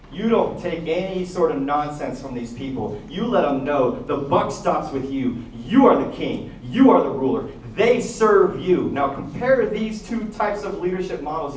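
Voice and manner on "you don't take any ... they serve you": loud, emphatic pep talk to a king; forceful urging. Acted. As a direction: push each phrase out slowly and loudly, pitch jumping up. Friends urge the king to dominate.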